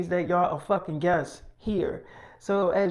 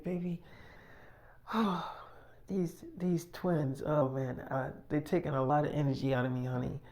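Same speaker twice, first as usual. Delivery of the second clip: soft, hushed, worn-out sigh; tired wife Ellie; 2 semitones lower, 8 dB quieter than usual. Retold or imitated. Ellie tells her husband the twins drain her, soft and weary. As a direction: sigh it out like an exhausted pregnant wife.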